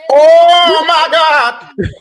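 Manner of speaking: high, loud oh my God; shocked shout at a roast; loud shocked cry. Real, not acted. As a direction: yell it in stunned outrage. Reacting to a roast.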